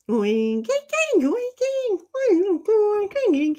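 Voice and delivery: high-pitched droning computer voice; computer speak. Pretend. Streamer jokingly reading a chat message in computer speak, high-pitched. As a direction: babble like a computer, high and mechanical. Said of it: "that was my computer speak".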